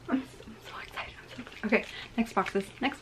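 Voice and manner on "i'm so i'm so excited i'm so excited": quick, giddy whisper; excited. Real, not acted. Collector before opening the next boxes, excited whisper. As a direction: whisper it fast, stumbling with excitement.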